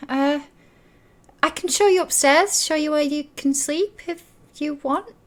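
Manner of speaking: auntie. Acted.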